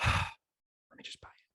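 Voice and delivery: sighed out, slow and voiceless; sigh, then whisper it in resignation; resigned whisper. Acted. Shopper giving in with Halloween days away, resigned whisper. Resigning himself to buying the costume.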